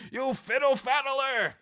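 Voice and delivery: high, loud, drawn-out playful accusation; mock outraged cry. Streamer teasing a viewer as fiddle faddler, mock outraged cry. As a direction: hurl it as a silly insult. Mock insult after laughing fit.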